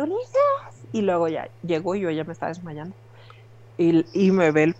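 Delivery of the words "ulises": retold call for help. On the elliptical, fainting, she cries out to her husband in a high mock call. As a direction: call out a name as you faint. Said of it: "le alcancé a gritar".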